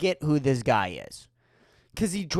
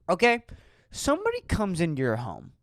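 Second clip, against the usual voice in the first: ordinary talk, nothing marked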